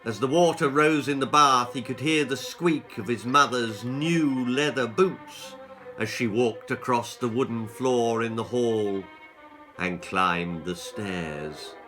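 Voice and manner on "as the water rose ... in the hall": ordinary delivery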